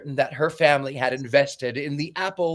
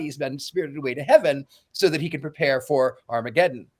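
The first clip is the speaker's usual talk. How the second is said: normal talking voice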